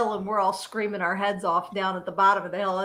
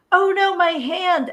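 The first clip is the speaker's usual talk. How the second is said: higher and louder, slow mock cry; imitating an amputee extra yelling about his hand; 8 semitones higher, 5 dB louder than usual. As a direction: cry out in fake pain. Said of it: "he's yelling".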